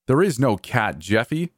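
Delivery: understated angry yell; mild yell. A narrator's reading. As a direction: snap it in exasperation, short of a real shout. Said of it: "he yelled".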